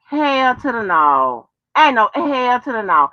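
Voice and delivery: usual speaking voice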